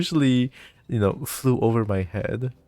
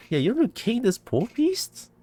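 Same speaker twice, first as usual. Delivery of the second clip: mocking disbelief; 10 semitones higher than usual. Real, not acted. Repeats order, mocking disbelief.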